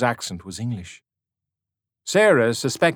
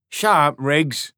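slow, firm and louder; stern rebuke; 5 semitones higher, 4 dB louder, slower than usual. A narrator's reading. Older soldier to Riggs, slow stern rebuke. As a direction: put him in his place.

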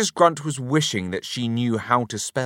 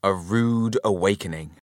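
ordinary delivery

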